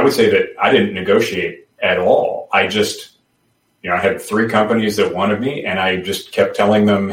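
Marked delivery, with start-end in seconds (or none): none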